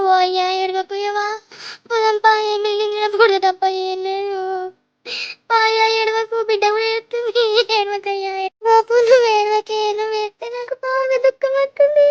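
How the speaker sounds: weeping while comforting; tearful consoling. Acted. Elder woman begs the crying family not to weep, tearful consoling.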